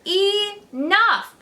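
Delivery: high, loud stern shout. A narrator's reading. Grandma shouting to stop the fight. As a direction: cut them off with a yell. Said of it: "Grandma shouted".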